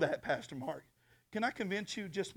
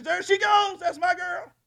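high, proud call; proud God; 9 dB louder than usual. Acted. God proudly spotting his daughter, high and bright. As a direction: point her out with pride. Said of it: "God looks down and says".

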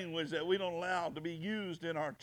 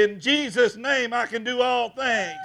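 raised, ringing preacher's declaration; loud proclamation; 5 semitones higher, 8 dB louder than usual. Acted. Preacher defying Satan before his church, loud proclamation. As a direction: proclaim it loudly to the whole church.

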